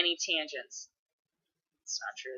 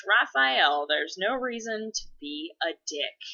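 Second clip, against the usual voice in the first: ordinary talk, nothing marked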